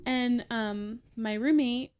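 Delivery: normal talking voice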